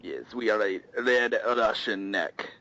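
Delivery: slow put-on Russian accent; fake Russian accent. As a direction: drawl it like a cartoon Russian. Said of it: "our fake Russian accents".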